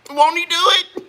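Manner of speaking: laughing with joy. Real, not acted. Delighted about banana pups.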